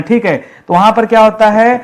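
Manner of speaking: usual speaking voice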